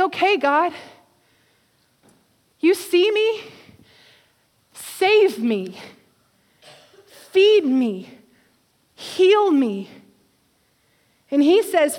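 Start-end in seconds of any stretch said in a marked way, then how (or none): 0.0-9.9 pleading prayer. Retold or imitated. Acted prayer within sermon.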